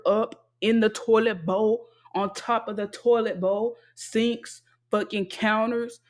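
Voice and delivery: slow, indignant itemizing; emphatic listing. Real, not acted. Complaining about lifelong scrubbing, slow emphatic list. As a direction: drag out each chore for emphasis.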